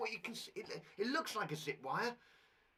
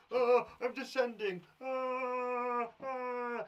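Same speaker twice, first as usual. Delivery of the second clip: mock descending cry; 6 semitones higher, 6 dB louder, much slower than usual. Acting out going down.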